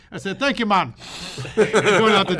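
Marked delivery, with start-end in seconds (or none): 0.4-0.9 put-on high, loud joke Jamaican accent; mock Jamaican accent. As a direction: put on a playful Jamaican lilt. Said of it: "[name] from Jamaica".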